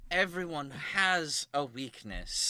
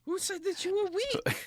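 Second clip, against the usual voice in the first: character voice; 3 dB louder than usual. Acted. Character reassuring, high voice.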